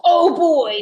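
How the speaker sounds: hammed-up dinosaur boom; loud booming Papa Dinosaur. A narrator's reading. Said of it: "in his loud, booming voice".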